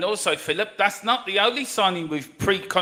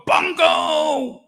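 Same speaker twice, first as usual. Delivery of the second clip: very high, loud shout of "Bongo!"; war cry; 12 semitones higher, 5 dB louder than usual. Pretend. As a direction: bellow it like charging into battle. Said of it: "Sounds like a war cry here".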